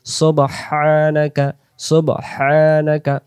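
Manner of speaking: slow, drawn-out "সুবহানাকা"; hissing long-letter ha. Shown as a demonstration. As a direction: stretch "সুবহানাকা" with a whooshing throaty h. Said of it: "শো শো আওয়াজে".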